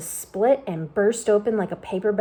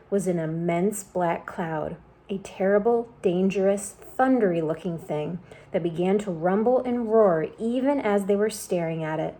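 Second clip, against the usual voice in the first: plain reading voice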